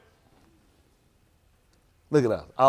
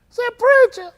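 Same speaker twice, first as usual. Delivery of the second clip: very high, squeaky put-on voice; mimicking voice; 4 dB louder, slower than usual. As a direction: squeak it out as a mocking imitation. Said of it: "Talk like that".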